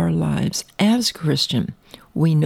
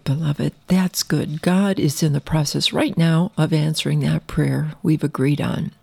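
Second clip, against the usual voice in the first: usual speaking voice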